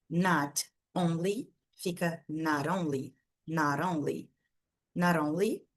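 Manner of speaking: "not only" said as "nar oli", the T a weak Brazilian R; flapped T. Shown as a demonstration. Teacher drilling the flapped T in not only for students. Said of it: "como um Rzinho mais fraco".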